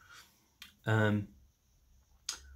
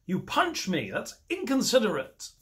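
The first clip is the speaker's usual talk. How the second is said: mock polite complaint; 6 semitones higher, slower than usual. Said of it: "you're meant to just be like".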